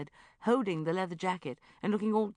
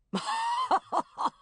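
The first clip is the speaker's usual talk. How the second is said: hooting uncle laugh; 6 dB louder than usual. Acted. Geoffrey laughing off.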